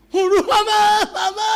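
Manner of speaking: high, loud cry for mama; hammed-up panicked child shout; child's yell. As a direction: yell like a child stuck up a tree. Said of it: "lalu di atas dia teriak".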